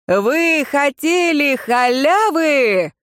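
slow, rolling concert shout; loud rolling shout. A narrator's reading. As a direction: call it out like on stage. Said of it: "Громко, раскатисто, как на концерте, прокричала я".

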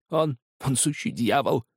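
put-on high, haunted old man; frightened agitation. A narrator's reading. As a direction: describe him in high-pitched dread. Drunk, frightened man describes his tormentor. (испуганно, высоким голосом)

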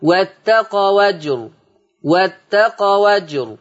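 واتقوا أجر slow with open waw, wattaqawajr; slow recitation; transferred vowel. Shown as a demonstration. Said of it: "هتبقى الواو مفتوحة".

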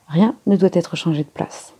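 ordinary delivery

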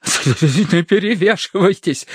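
high, slow, juddering order; teeth chattering. A narrator's reading. Terence in the water commands his rescuers, teeth chattering. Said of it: "клацая зубами".

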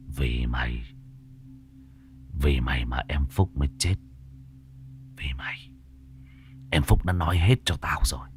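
seethe the accusation close to her face; menacing low threat. A narrator's reading.

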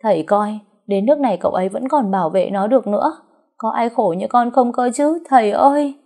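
high, loud whining lament; milk the tears, theatrical. A narrator's reading. Daughter-in-law whining to father-in-law, mock sobbing. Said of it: "Liên được đà càng khóc to".

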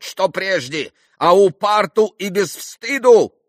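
put-on high, slow, loud Polish-accented protest; shouting Polish gentleman. A narrator's reading. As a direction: declaim wounded honour in Polish-accented Russian. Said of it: "закричал пан". (оскорблённый крик с польским акцентом)

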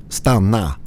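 Swedish: varnande rop; shouted warning. Acted. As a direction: halt your friend before he steps. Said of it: "skrek jag".

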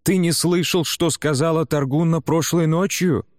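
high, indignant questioning; flaring up. A narrator's reading. Bjarki reminds his brother of last night, indignant. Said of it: "вспыхнул Бьярки".

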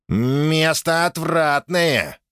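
slow, drawn-out demon growl of disgust. Acted. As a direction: sneer it like a fiend. (брезгливый демон)

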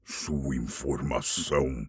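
low, cold, slow dragon retort. Acted. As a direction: brush off the hobbit coldly. (dragão retrucando com frieza)

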